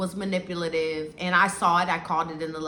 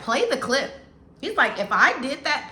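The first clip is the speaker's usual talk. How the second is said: insistent retold demand; 6 semitones higher, 4 dB louder than usual. Groom insisting clip be played.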